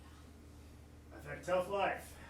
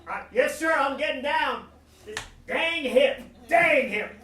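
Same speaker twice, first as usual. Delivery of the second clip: loud, high Southern cussing; frustrated Southern recruit, raised voice; Southern accent; 7 semitones higher, 9 dB louder than usual. Acted. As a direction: strain and holler in a Southern twang. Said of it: "Why am I speaking Southern again?".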